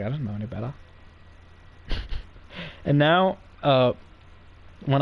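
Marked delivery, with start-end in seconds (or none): 0.0-0.7 much softer and lower, a sheepish mumble; meek mumble. Retold or imitated. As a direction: mumble it like a guilty newbie caught out. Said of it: "He's like".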